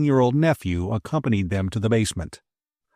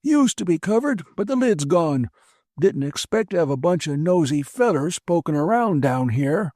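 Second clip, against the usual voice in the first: put-on high, huffy old man voice; a disdainful old man excusing himself; sniffy old man; 7 semitones higher than usual. A narrator's reading. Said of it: "The old man sniffed".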